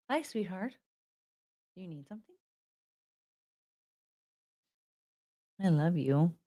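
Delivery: hushed loving words to a sleepy dog; tender hush. Real, not acted. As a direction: speak gently so you don't startle her. Softly talking to her dog.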